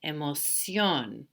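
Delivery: "Emoción" slowly, final "ción" stretched and stressed; careful model of "Emoción", weight on "ción"; stress on last syllable. Shown as a demonstration. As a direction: lean on the ending of "Emoción". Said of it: "telling me to do that".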